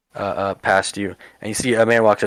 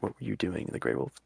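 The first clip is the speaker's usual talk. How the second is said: hushed, pressing repeat; quietly insistent question; 2 semitones lower, 13 dB quieter than usual. Acted. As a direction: press the question softly, ignoring everyone else. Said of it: "he, like, repeats his question".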